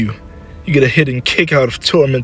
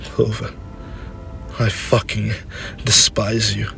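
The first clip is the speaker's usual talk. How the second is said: soft, halting bitterness; hurt, hushed contempt; 7 dB quieter than usual. Acted. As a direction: say it quietly to your son, wounded. Hurt mother, near tears, answers.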